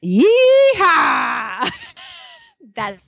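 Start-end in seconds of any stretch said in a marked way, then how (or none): 0.0-1.7 loud high cowboy whoop; zombie sound. Retold or imitated. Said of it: "his zombie sound sounds like this".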